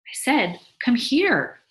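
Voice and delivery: insistent command. A narrator's reading. Wife repeats her summons firmly.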